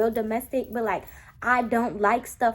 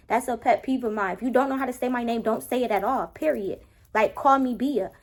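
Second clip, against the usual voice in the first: normal talking voice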